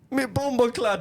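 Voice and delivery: high-pitched mock-Jamaican teasing; Jamaican accent. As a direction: greet him in an exaggerated Jamaican accent. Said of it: "and he's from Jamaica".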